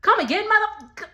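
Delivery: can't believe what she just heard; disbelieving come again? at the screen; incredulous. Real, not acted. Reacting to the cast member's line.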